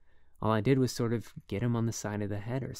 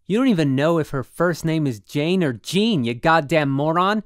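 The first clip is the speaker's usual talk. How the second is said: loud, higher, furious taunting; angry taunt; 5 semitones higher, 11 dB louder than usual. A narrator's reading. Holden taunting his roommate in a fight, loud furious yell. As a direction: yell the insult with full force.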